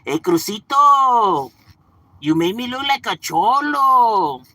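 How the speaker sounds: high, loud, slow mock Chicano movie voice. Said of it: "blood in, blood out impersonation".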